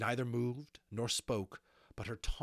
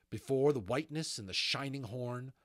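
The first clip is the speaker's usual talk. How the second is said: usual speaking voice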